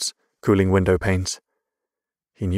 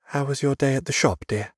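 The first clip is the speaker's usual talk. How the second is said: higher-pitched mother's voice; lightly raised voice for a polite mother; 7 semitones higher than usual. A narrator's reading. Mother asking Father about his day, polite.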